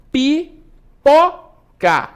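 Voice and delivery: pipoca very slowly in separate syllables, pi, po, ca, high and loud. Shown as a demonstration. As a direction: break pipoca apart, with a strong po. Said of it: "A sílaba tônica é o pó". (pipoca silabada, bem devagar, com pó forte)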